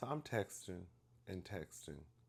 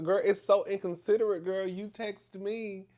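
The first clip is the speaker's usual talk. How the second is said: high, loud exasperated scolding; slow down and lean on each word, fed up; frustrated; 11 semitones higher, 8 dB louder than usual. Retold or imitated. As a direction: uncle telling off his niece, exasperated. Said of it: "now I'm getting frustrated".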